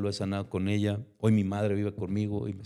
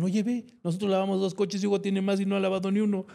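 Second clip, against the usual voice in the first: mock jealous complaint; 9 semitones higher than usual. Friends complain about his earnings.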